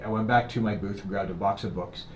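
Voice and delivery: usual speaking voice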